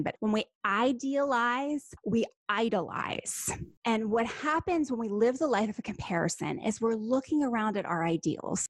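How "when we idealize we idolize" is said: "idealize" and "idolize" drawn out, each sound separated; slow and distinct. Shown as a demonstration. Teacher to listeners who may miss the distinction, slow and distinct. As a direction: say it slowly so the distinction is heard. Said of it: "I'll say it again slowly".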